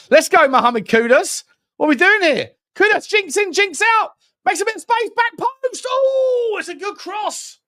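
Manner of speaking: building excited yell as Kudus goes through; excited commentary. Real, not acted. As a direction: ride the attack, voice climbing to a shout. Kudus attack in live match.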